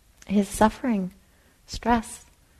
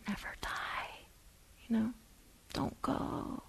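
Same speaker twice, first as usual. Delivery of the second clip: clinging whisper; 3 semitones higher, 19 dB quieter, slower than usual. Retold or imitated. Voicing craving clinging.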